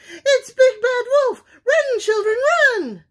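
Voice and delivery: high, loud frantic hen cry; panicked squawk. A narrator's reading. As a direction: shriek for the chicks to flee. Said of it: "squawked Missus Chicken".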